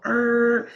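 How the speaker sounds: a high, squealing imitated screech; tire screech. Driver cutting the wheel, imitated high tire screech. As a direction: mimic the tires squealing.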